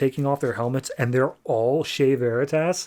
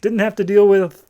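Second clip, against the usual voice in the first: loud, high, gleeful announcement; happily declaring good riddance; real happy relief; 6 semitones higher, 7 dB louder than usual. Real, not acted. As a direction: crow it with delight. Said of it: "I'm so happy about it".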